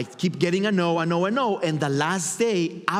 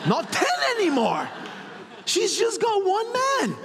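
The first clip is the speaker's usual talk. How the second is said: much higher, a laughing shout; excited shout. Real, not acted. Thrilled that she came with only one man, shouting. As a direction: burst out with delight.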